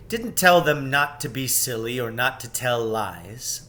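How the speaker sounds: usual speaking voice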